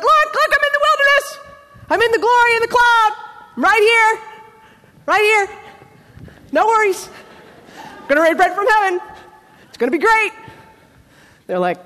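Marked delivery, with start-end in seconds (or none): 0.0-10.3 high, loud holler over a distance; hammed-up, high-pitched shout to the crowd; excited calling out. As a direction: call out eagerly to everyone far away. God mimicked calling out excitedly.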